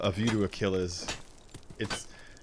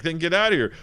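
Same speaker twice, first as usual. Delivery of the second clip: high, loud mock dismissal; hammed-up irritated boss; producer's dismissal; 8 semitones higher, 8 dB louder than usual. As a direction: throw them out in an acted shout. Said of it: "He's like".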